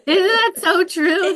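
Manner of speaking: delighted, laughing agreement; high, loud and slow through a laugh. Real, not acted. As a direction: laugh as you heartily agree. Laughing at the guest's story.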